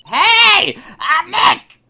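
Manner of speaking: very high, full-volume yell. Acted. [name] retries the noise-triggered camera, shouting at full volume. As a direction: yell it hard to set off the mic. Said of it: "Maybe I'm not saying it loud enough".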